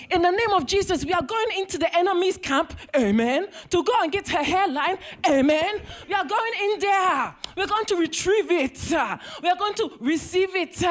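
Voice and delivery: high, fervent prayer-circle preaching; rally the church to fight for her hairline; Pentecostal preacher. Retold or imitated.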